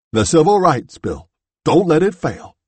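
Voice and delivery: a dying man's last loud, urgent appeal; urgent earnest entreaty. A narrator's reading. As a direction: press the plea harder, pleading loudly. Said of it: "hoarsely and in a tone of earnest entreaty".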